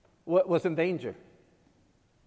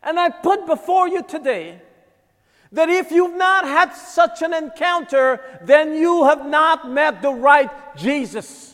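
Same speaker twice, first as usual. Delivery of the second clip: raised, loud pulpit voice, pressing each phrase; preaching at full force; 8 semitones higher, 7 dB louder than usual. Acted. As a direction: preach it out to the whole room. Preaching to congregation.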